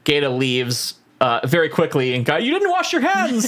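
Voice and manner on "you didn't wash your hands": high, drawn-out mock yell; mock calling out. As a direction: call it after someone hurrying off, pitched way up. Acts out calling after someone leaving.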